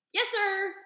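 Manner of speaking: high loud yell back. A narrator's reading. Girl yelling back to the preacher, loud high call. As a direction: call it back across the church. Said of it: "I yelled back".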